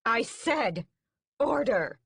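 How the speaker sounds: high, loud repeated command; queen commanding again, loud; exclaimed command. A narrator's reading. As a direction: insist on order. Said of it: "she exclaims".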